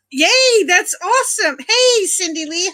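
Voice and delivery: high, delighted cheer of welcome; excited greeting. Real, not acted. Streamer welcoming a viewer who joins, excited greeting. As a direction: greet with a big, high-pitched yay.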